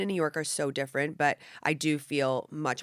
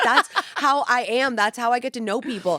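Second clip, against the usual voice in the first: mock defensive protest; 7 semitones higher, 7 dB louder than usual. Telling her guest how she defends her mean humor. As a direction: insist on it, exaggerated. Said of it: "I'm like".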